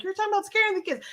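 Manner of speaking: quick whiny protest; imitating a critic of scaring kids, high and fast; imitating a listener. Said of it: "You're like".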